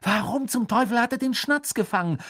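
high, incredulous yell. A narrator's reading. Ron jumps for joy yet baffled, high incredulous yell. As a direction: cry out the question in disbelief. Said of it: "brüllte Ron".

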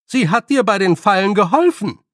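high, loud insistence. A narrator's reading. Nanny prompts old man about traps, loudly. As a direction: spell it out loudly for the listener. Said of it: "noch lauter".

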